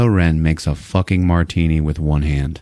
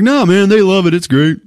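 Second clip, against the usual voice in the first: much higher, loud dude voice; loud dismissive enthusiasm; 11 semitones higher, 5 dB louder than usual. Retold or imitated. Douche stepdad defending the kids' movie, loud and enthusiastic. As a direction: brush it off with booming, high-pitched enthusiasm. Husband cheerfully dismisses the concern.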